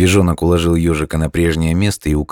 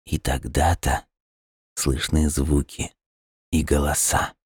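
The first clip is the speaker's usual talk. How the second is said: dreamy hush; 6 dB quieter than usual. A narrator's reading. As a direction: murmur it like a dream. Hedgehog dreamily describing sounds.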